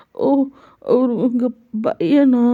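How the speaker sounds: put-on high little-boy voice, pouting; childlike sulk. Jungkook sulking at the mother about her son, high childlike voice. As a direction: toss it off like a sulking kid.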